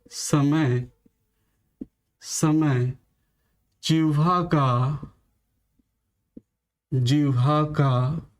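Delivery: slow, drawn-out phrases with long pauses for writing; teacher dictating notes word by word; slow dictation. Shown as a demonstration. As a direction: dictate each chunk slowly and repeat it. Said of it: "मैं एक लिख दे रहा हूं".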